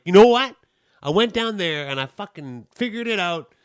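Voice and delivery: telling off the caller; angry reply to the callback; angry outburst. Retold or imitated.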